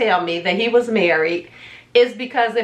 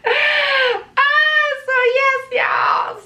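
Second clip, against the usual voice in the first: exasperated cry; much slower than usual. Real, not acted.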